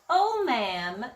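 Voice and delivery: ordinary delivery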